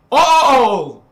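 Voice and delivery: ALL shouted high and loud, slowly; preaching shout. Real, not acted. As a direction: roar it out like a preacher at full force.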